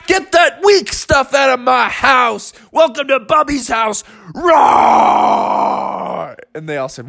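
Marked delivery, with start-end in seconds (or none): 0.1-4.0 high, hammed-up shout of a proud beast; fierce beast taunt. 4.3-6.4 long drawn-out roar; beast roar. Acted. Bubby roars at the others.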